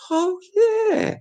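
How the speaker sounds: high squeaky falsetto; sudden falsetto yelp of delight; falsetto oh yeah. Pretend.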